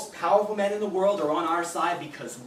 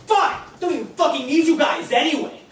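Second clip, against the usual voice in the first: much higher and louder, stung and spiteful; petulant furious shout; 7 semitones higher, 7 dB louder than usual. Acted. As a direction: hurl it back after being rejected. Rejected, lashing back.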